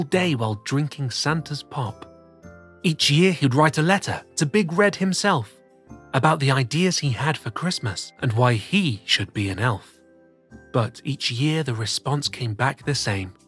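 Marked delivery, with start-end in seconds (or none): none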